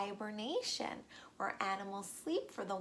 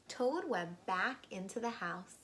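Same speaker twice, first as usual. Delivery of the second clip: ordinary delivery, nothing marked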